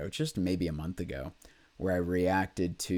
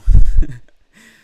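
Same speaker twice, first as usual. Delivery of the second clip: tearful laugh; 6 semitones higher, 24 dB louder than usual. Real, not acted. Tearful after painful memory.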